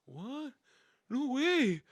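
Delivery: high-pitched, astonished gasp of words; acted disbelief. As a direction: act stunned by unexpected good news. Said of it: "Everybody's so confused".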